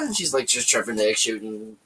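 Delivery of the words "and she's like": ordinary talk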